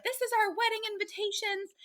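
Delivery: high-pitched mock outraged yelling; imitating angry fiancée. Fiancée confronting the other woman, high, imitated outrage. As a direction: play the betrayed fiancée, voice up high and shrill with outrage. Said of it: "And she's like".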